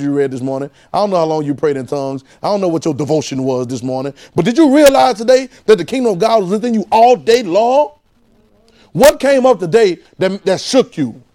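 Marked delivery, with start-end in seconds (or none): 4.3-11.2 higher, louder preaching. Acted. Preaching at full force.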